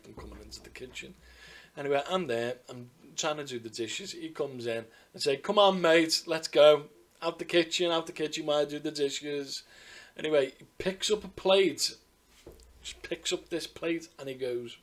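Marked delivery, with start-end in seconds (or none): 5.4-9.6 loud, higher, chivvying call; mock shooing. Care worker to man in the kitchen, loud chivvying. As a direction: chivvy him out, raised voice.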